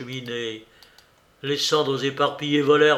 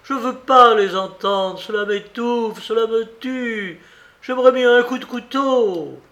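higher, louder desperate cry; desperate outburst; 8 semitones higher, 6 dB louder than usual. A narrator's reading. Maid begging her mistress to stop, in a loud anguished cry.

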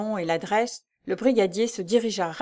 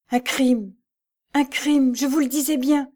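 choked, high and loud cry of alarm; strangled voice; 6 semitones higher, 4 dB louder than usual. A narrator's reading. Jeanne crying out to Madame Lebel, strangled and high. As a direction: voice tight with horror, pushing out the words. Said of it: "d'une voix étranglée".

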